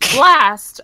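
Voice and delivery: loud theatrical curse; mock curse. Character cursing at bad news, hammed-up anger. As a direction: spit it out like a thwarted hero.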